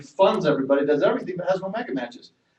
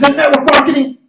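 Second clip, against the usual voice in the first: much higher, louder outraged wife; alarmed wife; 10 semitones higher, 12 dB louder than usual. Retold or imitated. Wife learns husband joined network marketing, outraged shout. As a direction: blow up like a horrified wife.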